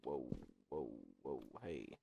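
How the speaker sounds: very quiet, low little calls; hushed exclamations. Real, not acted. Player reacting during a game fight, hushed and low. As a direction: drop right down under your breath.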